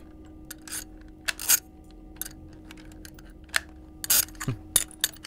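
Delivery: long breathy hushed chuckle; soft laugh. Acted. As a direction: laugh quietly, pleased. Scientist pleased, laughs.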